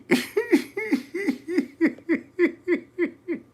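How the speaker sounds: high, loud cackling laugh. Real, not acted. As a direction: burst into a shrieking laugh. Cackling after crazy comment.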